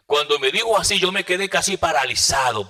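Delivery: preacher's astonished outburst; stunned exclaim. Real, not acted. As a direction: burst out in amazement. Recalling being stunned.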